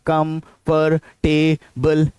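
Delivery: कंफर्टेबल drawn out slowly as "kum-fur-tay-bul"; four syllables. Shown as a demonstration. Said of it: "ना कि चार".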